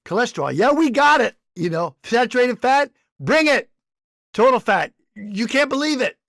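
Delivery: brash, boastful company spokesman; cocky brand voice. Retold or imitated. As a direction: crow it like a proud snack maker. Mocking brand voicing confident makers.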